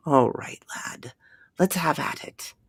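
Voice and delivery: whispered, resigned resolve; grim whisper. A narrator's reading. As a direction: mutter it under breath, steeling himself.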